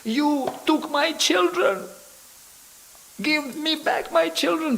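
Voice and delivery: very high, slow wailing mother's cry; screaming mother. Retold or imitated. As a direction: shriek it like a mother robbed of her sons. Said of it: "she was screaming at Prabhupada".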